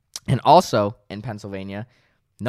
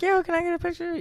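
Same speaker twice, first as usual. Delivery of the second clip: put-on excited fan girl; faster than usual. Fan girl asks for photo.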